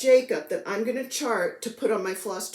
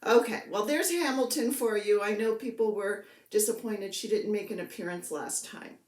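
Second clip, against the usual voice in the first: ordinary talk, nothing marked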